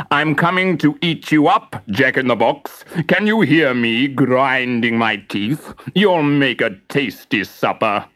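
lightly voiced, slow mock-menacing lion; a hint of a hungry lion threatening; growling lion. Lion threatening to eat Jack, slow and mock-menacing. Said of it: "sniffed at the box and growled".

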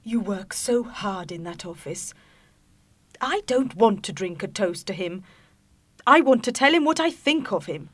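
high voice climbing from murmur to loud protest; wife refusing to toast her husband's boss; rising indignation. Acted. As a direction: let the anger build line by line. Wife's complaint grows.

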